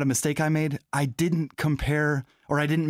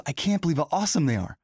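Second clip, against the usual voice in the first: usual speaking voice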